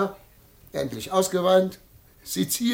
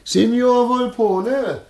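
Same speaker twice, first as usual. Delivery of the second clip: loud, drawn-out, higher greeting; loud hail; 6 semitones higher, 6 dB louder, slower than usual. Acted. Visitor addresses the sick man, loud hail. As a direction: call it out slowly to an invalid. Visitor hails the supposed invalid.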